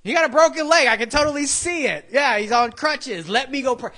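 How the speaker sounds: high, loud, eager approach. Retold or imitated. Showing the bold approach to a man on crutches, high and loud. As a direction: bound over ready to pray.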